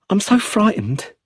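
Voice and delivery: high, fearful plea; a first frightened confession, voice lifted high. A narrator's reading. Brenda confessing her fear to Charles, high and frightened. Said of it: "Her fear was very real".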